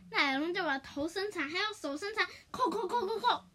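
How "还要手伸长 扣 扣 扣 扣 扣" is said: ordinary delivery, nothing marked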